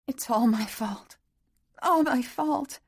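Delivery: put-on high, childlike guilty sobbing; sobbing young woman. A narrator's reading. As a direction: blame herself through tears.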